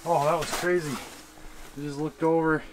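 normal talking voice

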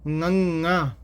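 ngੰਗਾ started with a clear velar nga; tongue to palate for ngੰਗਾ, nasal start; correct nasal ngਙ sound. Shown as a demonstration. Teacher demonstrates the exact ngੰਗਾ sound. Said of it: "ਜੀਭ ਥੋੜ੍ਹੀ ਜਿਹੀ ਤਾਲੂ ਨਾਲ".

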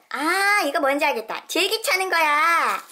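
loud aha moment; excited realization. Real, not acted. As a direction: announce the discovery proudly. Realizes what toy is.

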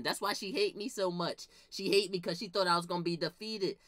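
ordinary delivery

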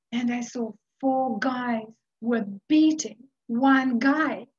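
ordinary talk, nothing marked

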